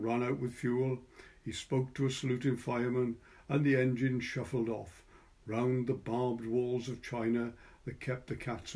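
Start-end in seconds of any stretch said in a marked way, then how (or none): none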